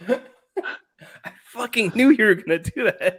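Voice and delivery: slow, high, laughing through the words. Real, not acted. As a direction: caught out and cracking up. Laughs at predicted soccer answer.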